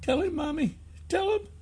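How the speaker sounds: put-on very high, soft child's voice; small boy's high, hushed plea; pleading little boy. A narrator's reading. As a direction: urge mommy in a tiny kid voice. Boy urges his mother.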